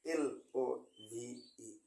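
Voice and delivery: letters এল ও ভি ই spelled very slowly; slow careful spelling of এল ও ভি ই. Shown as a demonstration. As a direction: spell এল ও ভি ই one letter at a time. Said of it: "এল বললে ছেড়ে দিলে হবে না".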